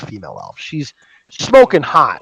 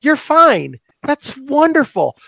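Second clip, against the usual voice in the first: relieved elf lady; 9 semitones higher, slower than usual. Acted. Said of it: "And she goes".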